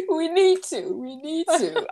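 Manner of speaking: laughing plea. Real, not acted.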